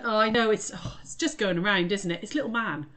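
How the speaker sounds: ordinary talk, nothing marked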